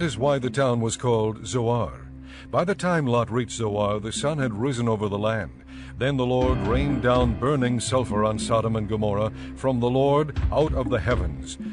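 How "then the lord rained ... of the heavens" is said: ordinary talk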